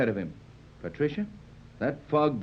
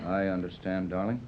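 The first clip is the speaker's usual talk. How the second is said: softer, lower, intimate reply; tender; 7 semitones lower, 5 dB quieter than usual. Acted. Tex reassuring nervous Patricia, softly tender. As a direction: comfort her gently.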